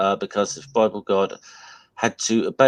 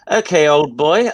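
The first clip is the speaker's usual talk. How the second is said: put-on playful British old-boy voice, louder and lifted; 5 semitones higher, 5 dB louder than usual. Obliging a friend who asked for the British voice, in playful British. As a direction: ham up the British accent a little, higher pitched. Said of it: "give it to us in that British voice".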